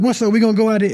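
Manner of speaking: high, loud street challenge; squaring up to a threat in the dark; loud street voice, startled awake and ready to fight. Retold or imitated.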